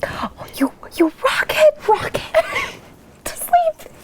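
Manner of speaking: high, breaking into laughs; laughing whisper. Acted. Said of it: "you're bad at this".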